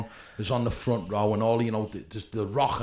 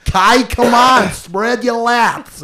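loud, high, drawn-out spectator holler; mimicking a vocal fan at full volume; imitated crowd shout; 8 semitones higher, 10 dB louder, much slower than usual. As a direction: stretch each word, yelling to a stranger on stage. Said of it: "they're shouting for fellas".

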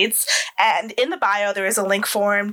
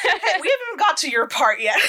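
laughing; 9 semitones higher, faster than usual. Real, not acted. Host laughing at guest.

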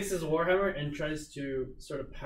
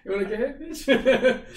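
higher, laughing mock-tough taunt; taunting threat; 9 semitones higher, 6 dB louder than usual. Warrior frozen mid-fight taunts spear woman, mock-tough. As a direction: dare her to swing, half joking.

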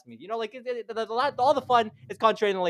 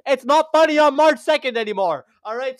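raised, higher voice driving the point home; rebutting the co-host's claim about March, raised and heated; heated rebuttal; 6 semitones higher, 6 dB louder than usual. Real, not acted. Pushing back on March jokes, loud and emphatic.

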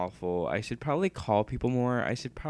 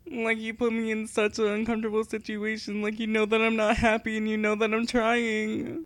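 whiny put-on voice; 10 semitones higher than usual. Acted complaint in a put-on voice.

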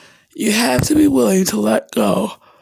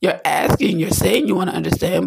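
ordinary delivery

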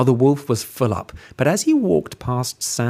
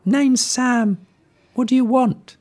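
wary little pig; 12 semitones higher, 3 dB louder than usual. Acted. Pig answering wolf.

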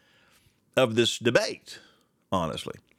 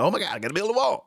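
high, rushed mock-panicked president; 7 semitones higher, much faster than usual. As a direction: blurt it as a suddenly alarmed leader.

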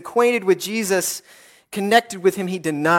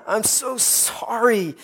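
high, slow, drawn-out apology; sorrowful cry; 4 semitones higher, 4 dB quieter, slower than usual. Acted. Preacher voices the cry of guilt Jesus carries, pleading sorrow. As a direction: plead it slowly on a raised pitch. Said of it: "This is a cry when".

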